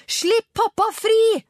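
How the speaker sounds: very high, loud, drawn-out scream; desperate howl. A narrator's reading. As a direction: howl it at the kidnappers. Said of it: "hylte han".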